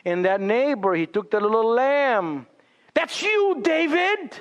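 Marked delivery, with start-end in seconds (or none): 2.9-4.4 prophet accusing. Retold or imitated. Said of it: "tell him a story".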